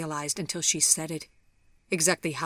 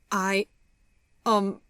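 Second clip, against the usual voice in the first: higher, halting, tearful start; choked-up hesitation, raised voice; holding back tears; 4 semitones higher, 5 dB louder than usual. A narrator's reading. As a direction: falter as you fight back tears. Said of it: "trying to control the tears".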